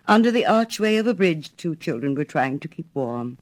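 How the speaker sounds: ordinary delivery, nothing marked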